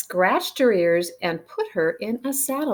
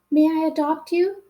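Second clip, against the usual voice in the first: lightly pitched-up sweet cat voice; sweet meow; 8 semitones higher than usual. A narrator's reading. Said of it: "meowed sweetly".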